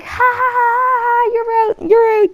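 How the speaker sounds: high taunting "ha ha, you're out"; mocking classmates. Classmates taunting the kid who got thrown out, mocking sing-song. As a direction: jeer like a kid in the playground.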